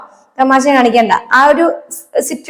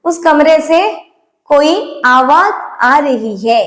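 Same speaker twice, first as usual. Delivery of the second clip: slow, careful Hindi model line; teacher saying the Hindi sentence slowly; much slower than usual. Shown as a demonstration. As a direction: stretch it out for learners. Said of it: "ആ മുറിയിൽ നിന്നും എന്തോ sound വരുന്നു".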